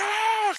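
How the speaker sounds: loud, high comic scream; mock terrified scream. Imitating a wrestler who finds a zombie ref, mock terrified scream. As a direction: shriek in hammed-up fright.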